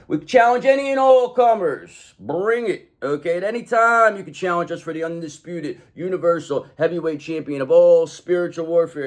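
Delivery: hammed-up ring announcer. Mock announcer calling challengers in a lightly hyped voice. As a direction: call it out like a fight night.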